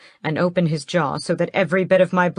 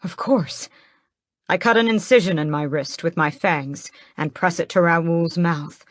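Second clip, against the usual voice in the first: plain reading voice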